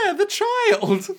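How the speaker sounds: very high, slow mock voice; high slow squeak. Repeats in high voice.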